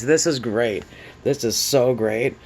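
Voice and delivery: ordinary talk, nothing marked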